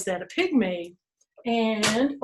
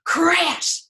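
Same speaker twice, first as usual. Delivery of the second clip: high, punched "Crash"; explosive demonstrated crash; crashing sound word; 7 semitones higher, 4 dB louder than usual. Shown as a demonstration. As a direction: burst out "Crash" like the noise. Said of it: "here's one that's not an animal".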